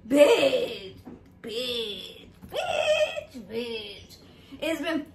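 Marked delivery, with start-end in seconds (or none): 0.1-0.9 laughing bitch. Pretend. Comic bitch chant intro. 1.4-2.2 low quiet bitch. Pretend. Comic bitch chant intro. 2.5-3.4 bitch squeaked way up high; cartoonish falsetto bitch; squeaky high bitch. Comic bitch chant intro. 3.5-4.2 crying bitch. Pretend. Comic bitch chant intro.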